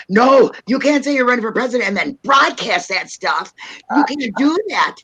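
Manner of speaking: mock maternal telling-off; scolding mother. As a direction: forbid it like an alarmed mother. Mother scolding her, retold.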